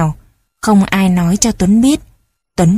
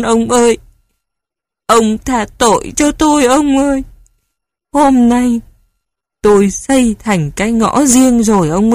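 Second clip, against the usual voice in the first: high keening cry to a dead husband; old woman sobbing out her grief; wailing at the altar, crying; wailing lament; 3 semitones higher than usual. A narrator's reading. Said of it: "bà khóc lăn khóc lóc".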